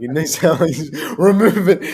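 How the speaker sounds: retold disgust dissolving into laughter; laughing quote. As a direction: crack up mid-line while retelling. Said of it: "I'm like".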